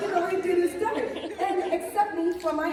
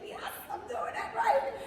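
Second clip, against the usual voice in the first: whispered glee; 8 semitones higher, 3 dB quieter, slower than usual. Retold or imitated. Bride delighted walking down aisle.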